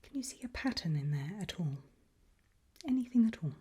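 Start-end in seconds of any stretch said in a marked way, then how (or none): none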